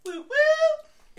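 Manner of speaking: loud, high whooping cheer; party whoop. Pretend. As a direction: cheer like at a bash.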